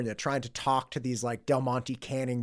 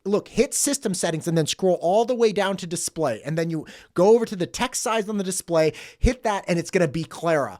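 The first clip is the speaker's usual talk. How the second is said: loud, raised, eager walkthrough; excited instructions; 5 semitones higher, 8 dB louder than usual. Retold or imitated. Retold worker guiding his buddy to the find, excited instructions. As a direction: imitate a guy rattling off directions with relish.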